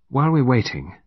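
casual, lightly curious; conversational. A narrator's reading. Murderess chats to the detective while waiting, conversational. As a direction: make easy small talk of it. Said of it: "in a conversational tone".

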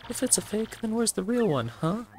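high-pitched, incredulous villain protest; protesting Bowser. Acted. Bowser objects that the fancy display holds a fake, incredulous. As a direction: whine in disbelief at being fooled.

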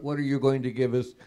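usual speaking voice

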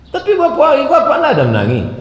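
high, fast acted question; high-pitched imitated puzzlement; incredulous friend. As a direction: question him quickly in disbelief. Friend questions the crying pilgrim.

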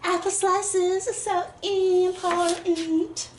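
high, slow mock-dramatic declaration; hammed-up melodrama. Cook playfully declaring apple slices precious, mock melodrama. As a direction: stretch it out high with playful overacting.

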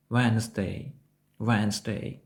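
"Wednesday" said WENZ-day; silent D. Shown as a demonstration. Teacher models the correct weekday word, silent D. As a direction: say "Wednesday" in two syllables, first D dropped. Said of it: "якщо ви вимовляєте букву D".

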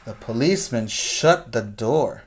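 plain reading voice

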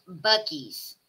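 Bucky's said very slowly, with a plain k and no s before the y; careful pronunciation. Shown as a demonstration. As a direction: spell out Bucky's slowly, syllable by syllable.

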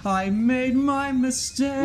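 slow high mournful voice; mock lament. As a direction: moan like a sad cartoon.

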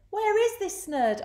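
high, insistent storybook crook; demanding crook. A narrator's reading.

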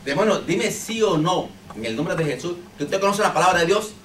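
放慢而大声地逼问; 慢慢加重，像审问一样喊出; commanding the demon. Retold or imitated. Pastor retells commanding the demon.

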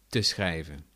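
usual speaking voice

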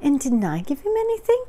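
high, quick plaintive question; Christopher Robin, sad. A narrator's reading. Christopher Robin asks his dad, high and sad. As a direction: ask in a small, high, hurt voice. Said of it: "asked Christopher Robin sadly".

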